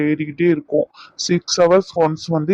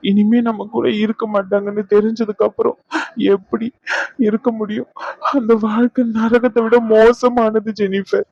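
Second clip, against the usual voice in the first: higher, tearful protest; emotional outburst; 5 semitones higher than usual. Acted. Grieving son tells Jennifer he cannot stop crying, tearful. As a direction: pour out the grief, voice rising. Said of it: "கொஞ்சம் emotional ஆயிட்டேன்".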